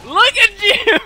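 high, loud excited shout; hyped gamer bro. Real, not acted. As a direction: shout it like a big play. Shouting at opponent's play.

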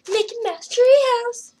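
very high, loud cartoon squeak; squeaky helium voice. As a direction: pipe it up like a tiny mouse. Joke suggestion in helium squeak.

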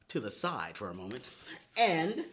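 normal talking voice

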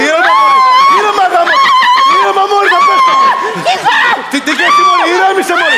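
very high, frantic pleas; panicked yelling. Pretend. Host afraid of falling begs contestant, panicked yelling. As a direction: cry out in alarm, voice leaping way up.

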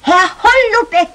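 loud high call through the door. Acted. Nachbarin ruft durch die Tür. (lauter Ruf durch die Tür)

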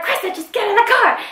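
very high mock yell; exasperated calling out. Friends urge her away from the argument, high exasperated mock yell. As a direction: imitate pleading friends hollering, voice pitched right up.

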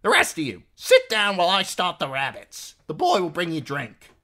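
high, loud hostess giving orders; bossy innkeeper's wife. A narrator's reading. Innkeeper's wife seating her guests, loud bossy voice.

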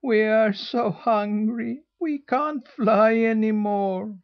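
high, slow, whimpering gosling voice. A narrator's reading. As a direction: whimper it like starving little geese. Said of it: "whimpered the goslings".

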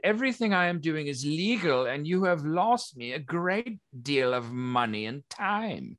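lightly voiced aggrieved official; indignant licensed researcher. Researcher tells objectors they cost money and time, mildly indignant. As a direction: insist on your legal rights.

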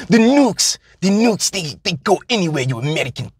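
put-on high, foreign-accented terrorist sneer; a hammed-up foreign accent spitting at an American; mock foreign villain accent, pitched high; vague foreign villain accent. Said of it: "don't ask me where this, um, accent is from".

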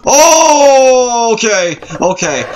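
loud high scream falling into speech; long high shriek, then recovering; startled. Real, not acted. Player hit by a jump scare, startled scream.

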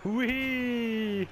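high, playful squeal of fun; gleeful whee. Real, not acted. Flying with jetpack.

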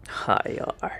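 soft breathy whisper; whispered echo. Pretend. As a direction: whisper the words back. Echoes in a whisper.

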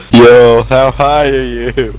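loud drawn-out meme yell; meme shout. Retold or imitated. Quoting a meme loudly to his friend. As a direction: belt the meme line slowly.

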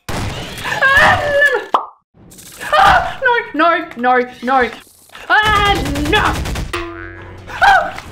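high panicked screaming; frantic terrified yelling; scared horror game streamer. Real, not acted. Chased, panicking at controls.

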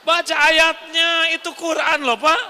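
high, loud insistence; fervent climax. Real, not acted. Preacher urging the men to read the Quran verse, loud and high. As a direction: preach the verse with full fire.

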